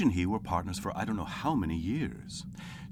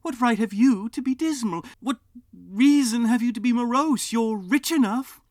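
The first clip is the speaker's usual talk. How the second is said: put-on high, louder playful young voice; 10 dB louder than usual. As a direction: throw his words back cheerfully. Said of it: "returned the nephew gaily".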